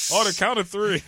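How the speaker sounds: game show host. Retold or imitated. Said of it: "Your game show host voice kills me".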